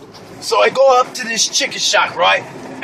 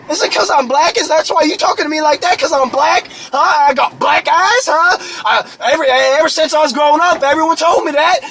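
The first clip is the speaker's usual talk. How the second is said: raised, affronted mock challenge; indignant cashier; 5 semitones higher than usual. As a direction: pitch up, pressing the accusation. Cashier accuses customer, acted quarrel.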